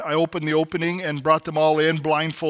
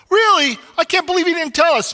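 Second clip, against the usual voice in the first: much higher, louder outraged cry; indignant disbelief; 14 semitones higher, 8 dB louder than usual. Retold or imitated. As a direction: squawk in disbelief. Campers indignant Billy left.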